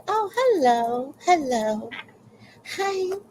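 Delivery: high, drawn-out hello; acted high-pitched politeness; polite greeting. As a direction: greet a new neighbor in a put-on high voice. Acts out own polite greeting back.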